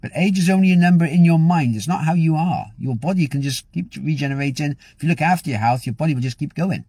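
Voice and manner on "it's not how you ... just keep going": ordinary talk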